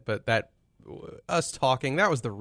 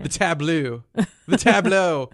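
lightly mocking tableau, rising then fading; quoting Dexter; 5 semitones higher, 5 dB louder than usual. Quoting Dexter's overused word, mock-dramatic. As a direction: toss tableau around like a catchphrase. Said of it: "To quote".

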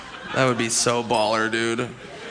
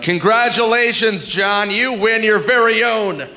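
higher, slow, grandly announcing the prize; game show host; 8 semitones higher, slower than usual. Retold or imitated. Host proudly presenting a wolf as the prize, in an overblown announcer voice. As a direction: sell the wolf like a luxury car. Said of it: "If they're like".

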